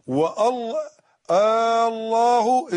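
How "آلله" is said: «الله» with a long questioning «آ» at the front; interrogative hamza. Shown as a demonstration. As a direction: drag out the first vowel of «الله». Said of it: "الله استفهام". («الله» بهمزة استفهام ممدودة)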